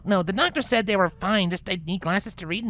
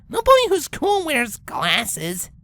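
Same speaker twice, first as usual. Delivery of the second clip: lamenting; 5 semitones higher, 4 dB louder, slower than usual. Acted. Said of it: "Rainbow lamented".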